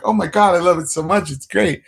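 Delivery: put-on thrilled voice; mock delight. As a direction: gush over a gift, playfully acted. Delighted gift recipient voiced.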